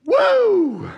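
high laughing woo; laughing whoop. Real, not acted. Preacher whooping with a laugh at the claim.

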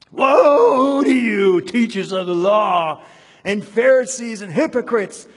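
loud, high, slow thundering sermon delivery; preaching at full force. Acted. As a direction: hurl the woe at the crowd from the pulpit. Reads Jesus' rebuke from scripture.

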